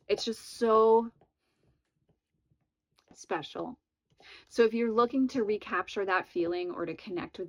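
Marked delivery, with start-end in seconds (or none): 0.1-3.8 fighting tears while praising it; holding back tears. Real, not acted. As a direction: voice catching, nearly crying. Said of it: "I'm not gonna cry about this".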